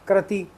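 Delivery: कृति as "krati"; the everyday slip "kra-ti" for कृति; common mispronunciation. Shown as a demonstration. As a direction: say कृति with "kra", not "kri". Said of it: "normally हम क्या पढ़ते हैं".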